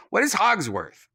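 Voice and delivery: normal talking voice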